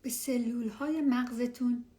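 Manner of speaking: hushed and calm, guide them down; spa whisper. Acted. Leads a meditation.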